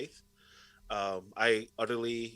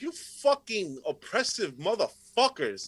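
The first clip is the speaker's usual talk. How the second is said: higher, slow, dragged-out furious curse; retold slow fury; 9 semitones higher, 4 dB louder, slower than usual. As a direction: grind out each word in contempt. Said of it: "I'm like".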